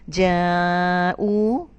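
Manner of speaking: جاء with the long aa held very long; drawn-out recitation. Shown as a demonstration. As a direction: stretch جاء slowly as in Quran recitation. Quran word drawn out.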